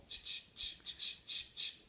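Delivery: slow whispered ch-ch clicks imitating Morse; Morse code clicks. Shown as a demonstration. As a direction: tap out Morse with your mouth. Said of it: "invitations in Morse".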